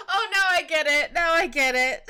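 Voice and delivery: high, loud laughing through tears; laughing realization. Real, not acted. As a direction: crack up as it finally clicks. Sudden realization laughing crying.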